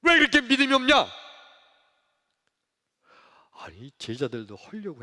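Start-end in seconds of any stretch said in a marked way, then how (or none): 0.0-1.1 high, loud, rapid rebuke; scolding. Acted. Jesus to his disciples who tried hard, scolding sharply. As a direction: burst out telling them off. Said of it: "야단치신 거예요".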